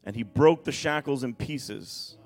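dramatic pulpit reading, stretching weighty words; emphatic scripture reading. Acted. Preacher reading the storm and tombs story to church, emphatic preaching.

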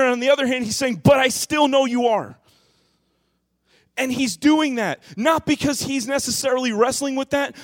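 high, loud preaching at full force; impassioned sermon peak; fiery preaching. Acted. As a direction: drive the point home from the pulpit.

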